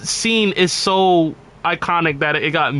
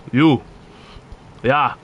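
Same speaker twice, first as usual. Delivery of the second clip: lightly put-on Nordic guard accent; a Skyrim guard accent, stern. Streamer parodying a Skyrim guard, lightly put-on Nordic accent. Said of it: "it's the fucking accent kills me".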